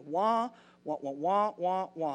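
mock trombone wah wah; droning adult-in-cartoon wah wah; imitating the muffled wah wah of a boring voice; muted trumpet wah wah. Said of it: "here's what God hears".

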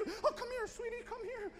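high, hushed mock mother voice; imitating scared mothers. Mothers grabbing their kids away from a yelling man, mimicked high and hushed. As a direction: go high and small, pulling a child close. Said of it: "and they're scared".

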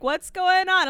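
high, loud puzzled call; imitated alarmed partner; retold partner's question. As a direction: call out in mock concern.

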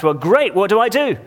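relieved man calling out keenly; happy shout. Acted. Rescued man eagerly shouts up to God, high and bright. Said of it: "he shouted".

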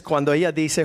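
ordinary delivery

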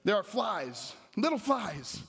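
high-pitched, insistent preacher's cadence; emphatic preaching. Real, not acted. Preacher warning the church about little distractions, insistent.

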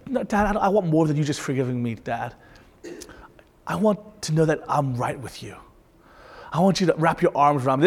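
put-on soft, small pleading child; pleading son. Son asking dad for a hug, soft and pleading. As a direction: beg quietly like a kid wanting a hug. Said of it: "It's like my son".

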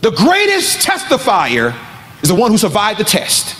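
emphatic preacher's proclamation with high peaks; impassioned preaching. Real, not acted. Preacher declaring the story is not over, impassioned preaching. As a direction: proclaim hope to the congregation.

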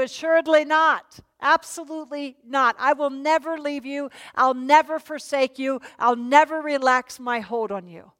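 preacher reassuring congregation; emphatic preaching. Shown as a demonstration. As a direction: press each never home. Preacher paraphrases promise emphatically.

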